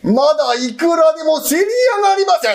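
loud, high, rising shout to show off his voice. Acted. Landlord showing off his loud natural voice with a shout. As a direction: push the voice up as far as it goes. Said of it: "大きな声は地声だ".

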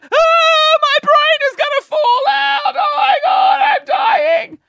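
very high, loud panicked shrieking; hammed-up panic. Kid sees the blood, shrieking in mock panic. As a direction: shriek it like a kid sure he's dying. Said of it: "Of course, I'm like".

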